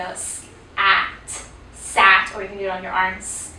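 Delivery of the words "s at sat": "s-" hissed, "at", then "sat" blended louder; tapped sound blending. Shown as a demonstration. Teacher shows how kids tap and blend sat. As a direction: split then blend the word. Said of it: "tap them where they go".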